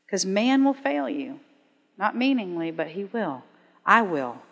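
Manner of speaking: tender appeal. Acted. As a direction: hush down and speak heart to heart.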